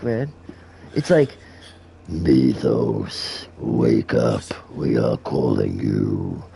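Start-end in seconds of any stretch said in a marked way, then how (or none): 2.1-6.4 very low, slow summoning demon. Acted. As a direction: call out slowly in a deep demonic voice. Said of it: "do the demon voice".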